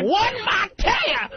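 put-on hollering old-timer miner; old prospector. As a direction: shout it like a crusty prospector. Joking about old gold miner.

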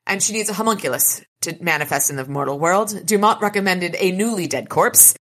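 ordinary talk, nothing marked

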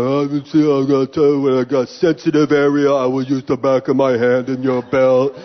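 mock agent rattling off a rote speech; droning, mush-mouthed imitation of an official spiel; recited officer speech. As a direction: recite it like a bored screener's script. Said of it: "the guy's giving me the speech".

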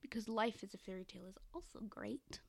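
unvoiced, soft whisper. Real, not acted. Whispering a defence of another song. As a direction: say it under your breath.

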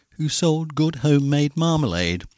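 ordinary delivery

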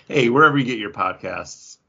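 ordinary delivery

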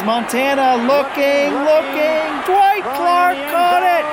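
high, rising excited play-by-play call; a commentator calling the catch, building excitement; imitating a sportscaster's call. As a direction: call it like the famous broadcast moment. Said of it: "He's the one who says".

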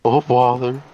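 fretting cartoon bear; Winnie the Pooh. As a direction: do a storybook bear impression. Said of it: "similar voice to regular Winnie the Pooh".

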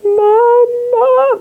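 high, loud panicked cry for mom; child's panicked yell. Retold or imitated. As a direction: yell for mom like a frantic kid. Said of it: "han kommer upp och bara". (högt panikslaget barnrop)

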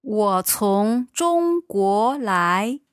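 ordinary talk

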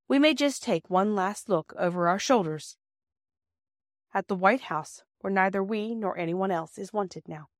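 ordinary delivery, nothing marked

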